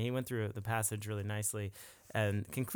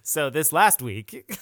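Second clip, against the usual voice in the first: slow, loud, higher announcer; radio voice; 5 semitones higher, 12 dB louder, slower than usual. Pretend. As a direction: open like a radio host. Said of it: "Jared asked me to get a radio voice".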